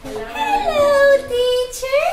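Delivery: high, sing-song class reply; high child greeting. Shown as a demonstration. Teacher modelling pupils' reply, high and sing-song. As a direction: sound like a young pupil greeting. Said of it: "how do you say that".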